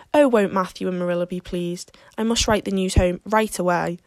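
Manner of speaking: ordinary delivery, nothing marked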